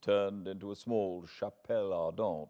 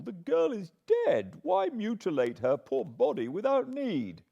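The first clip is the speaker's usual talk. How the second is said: higher, louder shocked protest; rising indignant protest, higher and louder; protesting outburst; 5 semitones higher, 4 dB louder than usual. A narrator's reading. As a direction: cry out against it as monstrous. John protests the operation.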